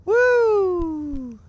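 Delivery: high excited whoop. Real, not acted. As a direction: whoop for the donations. Cheering as bits drop.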